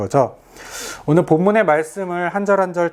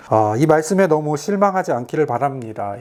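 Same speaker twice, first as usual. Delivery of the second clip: usual speaking voice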